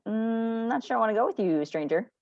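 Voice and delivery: wary dog voice. Retold or imitated. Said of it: "the dog is leery and says".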